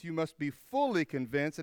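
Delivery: fully held long and lifted; stress on fully. Shown as a demonstration. Pastor insisting to his flock, fully stressed. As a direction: lean hard on fully before convinced. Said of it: "I emphasize the word fully".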